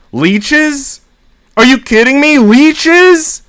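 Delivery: high, loud shriek of disbelief; shocked disbelief. Acted. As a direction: squeal it like you just found leeches on your legs. Finds leeches on his legs.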